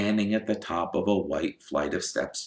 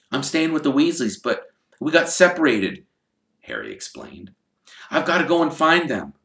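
put-on higher boyish voice, explaining; young Harry voice; 9 semitones higher, 4 dB louder than usual. Harry telling Hagrid about the Weasleys, in a higher boyish voice. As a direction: keep it light and eager.

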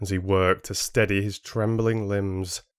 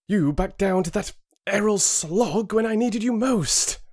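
much higher, strained cry of betrayal; pained outburst; 13 semitones higher than usual. Acted. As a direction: hurl it at your father. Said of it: "features twisted in a look of pain".

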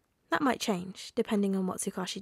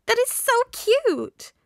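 very high, loud, drawn-out squeal; 13 semitones higher, 8 dB louder, much slower than usual. A narrator's reading. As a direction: gush in a slow, delighted squeal. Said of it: "Ashido squealed".

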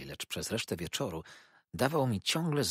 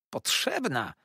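high, offended Potrzebna; huffy repetition; mother taking offence at her daughter; indignant; 10 semitones higher, 3 dB louder, slower than usual. A narrator's reading. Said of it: "oburzyła się".

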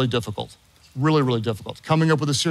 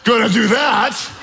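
high, emphatic comic declaration; triumphant relief; 7 semitones higher than usual. Retold or imitated. Nervous student pilot realising he is safe, emphatic relief. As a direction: pitch it high and hammer each "ever".

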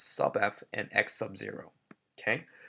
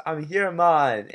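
bad Jamaican impression; 5 semitones higher, 8 dB louder, slower than usual. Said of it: "a very bad impression of a Jamaican man".

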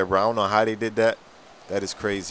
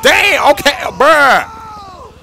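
high, loud jumpy yelps after a scare; real shaken shout, then a slow relieved groan; startled fright; 11 semitones higher, 9 dB louder, slower than usual. Real, not acted. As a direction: react as if a figure just lunged at you. Startled by a moving prop.

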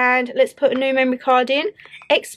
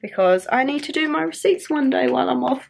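ordinary talk, nothing marked

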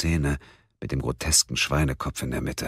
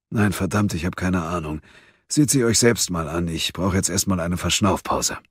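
leicht rauer älterer Mann, etwas höher; alter Mann, ratlos; 5 semitones higher than usual. A narrator's reading. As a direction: ratloser alter Ermittler, genervt. Vic, alter Ermittler, ratlos. (ein alter Ermittler ratlos zu Munk und Mia, leicht gespielt)

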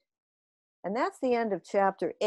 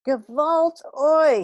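slow, loud, higher yell; alien yell; 7 semitones higher, 7 dB louder, slower than usual. A narrator's reading. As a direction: wail it out slowly. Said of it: "he yelled".

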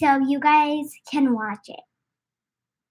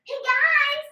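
excited greeting; 12 semitones higher than usual. Real, not acted. Child bursting in on dad's livestream, excited greeting. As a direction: call out high to everyone watching.

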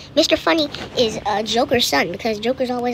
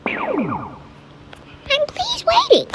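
high-pitched, exasperated plea; impatient whine; much slower than usual. Real, not acted. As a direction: pitch it way up, fed up with waiting. Third take, more impatient.

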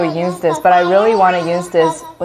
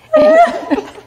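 high-pitched laugh; 4 dB louder than usual. Shown as a demonstration. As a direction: laugh pitched way up through a voice changer. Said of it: "This is how my voice would sound like".

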